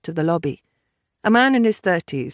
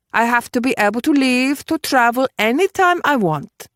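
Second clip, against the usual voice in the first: assertive woman setting conditions; emphatic, unyielding character voice; Judith, firm; 5 semitones higher, 4 dB louder than usual. Acted. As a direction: lay down the law point by point. Voices Judith negotiating firmly.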